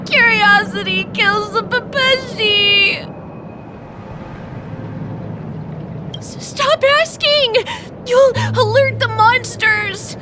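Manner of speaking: frightened man on the edge of hysterics; rattled and fearful, breaking into nervous sobbing laughter; spiralling into panic; panicked. Acted. Panicked man sees unseen monsters.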